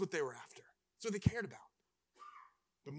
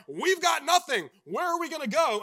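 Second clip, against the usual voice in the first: panicked Israelites; 7 semitones higher, 10 dB louder than usual. Retold or imitated. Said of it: "they're like".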